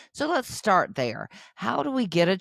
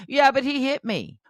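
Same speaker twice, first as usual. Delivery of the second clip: put-on high, loud little-kid protest; imitated high-pitched kid objection; indignant child; 12 semitones higher, 5 dB louder than usual. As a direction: play a child protesting the blame. Acted quarrel between two children.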